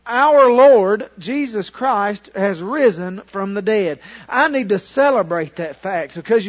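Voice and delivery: ordinary talk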